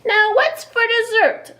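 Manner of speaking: greedy bear. Acted. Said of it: "said the bear".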